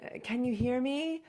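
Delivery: sheepish mock mic check; awkward mic check. Speaker opening with a technical question, lightly imitated. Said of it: "Or, um".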